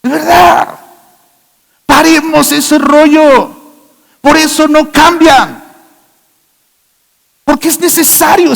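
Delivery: grito de púlpito, alto y fuerte; predicación a toda voz. Acted. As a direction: exhorta a la iglesia gritando. (predicación ferviente a gritos)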